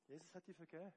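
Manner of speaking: normal talking voice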